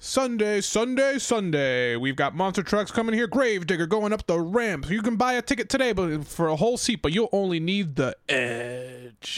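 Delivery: high hyped announcer pitch; monster truck ad. Pretend. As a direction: sell the arena show, fast. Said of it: "Give it a go".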